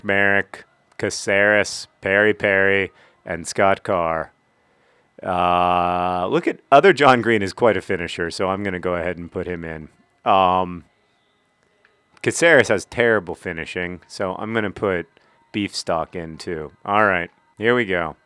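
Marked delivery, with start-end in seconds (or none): none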